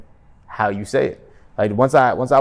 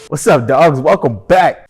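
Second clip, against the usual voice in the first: higher, louder, pumped-up greeting; excited; 5 semitones higher, 8 dB louder, slower than usual. Shown as a demonstration. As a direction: hype it up like an excited hello. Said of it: "I was excited".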